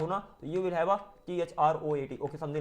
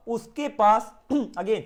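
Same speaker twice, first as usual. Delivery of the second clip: usual speaking voice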